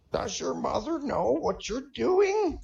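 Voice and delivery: put-on high, slow little-old-lady voice; scolding old lady. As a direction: scold him like a prim old lady, slowly. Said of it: "looks at him scoldingly and says".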